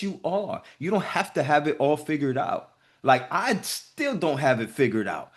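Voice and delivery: ordinary talk, nothing marked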